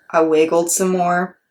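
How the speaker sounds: plain reading voice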